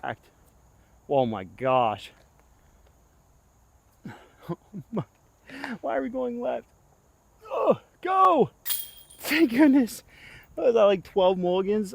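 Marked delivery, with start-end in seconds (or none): 5.8-11.9 exasperated outburst. Real, not acted. Frustrated at missed putts.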